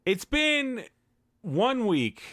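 high, hammed-up voice cracking with emotion; voice breaking. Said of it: "I'm getting a little choked up".

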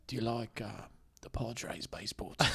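quiet, hushed British impression; Jason Statham. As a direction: ask softly, close to the mic. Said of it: "whisper it a little bit".

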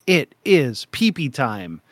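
ordinary talk

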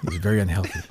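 low, soft Trump-style mock; lightly voiced Trump cadence; Trump-like impression. Cohost repeating the host's line, mock Trump delivery. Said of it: "he's got a Trump".

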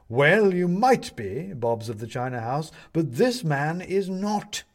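high, loud, slow pronouncement; pompous owl judge. Acted. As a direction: rule slowly and grandly from the bench.

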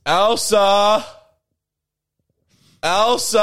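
calling loudly through the house. Real, not acted. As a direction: shout her name from another room. Calling roommate into the room.

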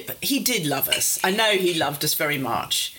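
usual speaking voice

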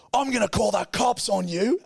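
high-pitched mock yelled threat; imitating cranky screamer. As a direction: act out a cranky man screaming. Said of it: "screaming at me".

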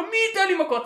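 high mocking driver's retort; mock angry driver. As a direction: squeak out the retort. Driver repeating.

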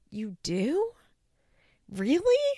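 "Really?" leaps high in disbelief; incredulous. Retold or imitated. Host answering Macy on TV, high incredulous disbelief. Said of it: "I'm like".

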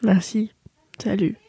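soft, weak whisper; barely-voiced farewell; whispered goodbye. Real, not acted. Sick friend saying goodbye softly.

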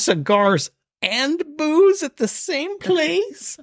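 very high, drawn-out amazement; awed delight. Retold or imitated. Shopper marveling at one-stop cigars and booze, high and rapturous. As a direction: stretch it out, soaring with joy. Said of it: "I thought to myself in that moment".

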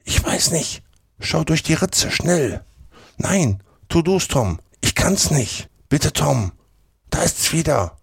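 put-on high, hushed, urgent boys' exchange; frightened whispering. A narrator's reading. Two boys urge each other to look, frightened. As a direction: plead in a high, scared half-whisper.

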